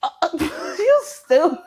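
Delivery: high, laughing mock insult; playful jab through laughter; laughing tease. Real, not acted. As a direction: laugh it out at your friend. Laughing at friend's giggle.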